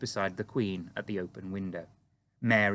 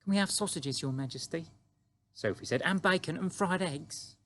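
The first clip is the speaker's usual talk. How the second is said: put-on higher, quick little girl's voice; 8 semitones higher than usual. A narrator's reading. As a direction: explain eagerly like a small girl. Sophie voiced in story.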